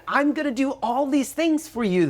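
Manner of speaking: high, whiny pleading with God; mock-earnest plea, pitched high. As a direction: beg like a kid insisting.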